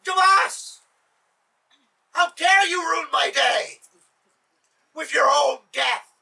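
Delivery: enraged shouting Sultan. Acted. Sultan enraged at Jabas again.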